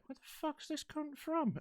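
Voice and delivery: high, soft, slow mock bafflement; baffled audience member. Audience member puzzled by the accent, high and hushed. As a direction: wonder it aloud, drawn out and high. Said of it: "I'd be like".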